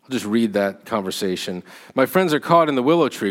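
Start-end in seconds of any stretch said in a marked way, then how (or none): 1.9-3.3 fast, louder and higher cry; breathless cry. Acted. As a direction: blurt it out in a rush. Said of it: "cried Frodo breathlessly".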